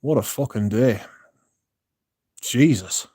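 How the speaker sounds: ordinary delivery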